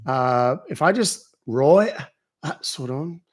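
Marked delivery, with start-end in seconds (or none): 1.4-3.2 brief Aussie one-liner; Australian accent. Shown as a demonstration. Streamer demonstrating a one-liner, Australian accent. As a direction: toss off a snatch of Australian. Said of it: "Australian, kind of the same way".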